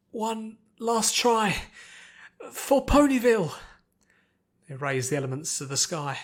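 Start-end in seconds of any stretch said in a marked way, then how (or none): 0.1-3.5 very slow, high then loud battle cry; rallying shout. Acted. Twilight rallying friends for one last try, loud and high. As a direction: call everyone to one last push. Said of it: "Twilight rallied her friends".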